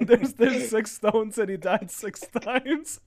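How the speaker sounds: words broken by laughter, high and slow; cracking up while talking; laughing. Real, not acted. Laughing at friend's video.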